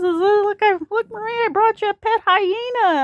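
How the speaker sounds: high-pitched, playful impression of dad; imitating dad. Dad presenting a pet hyena to his daughter, hammed-up. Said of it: "He just says".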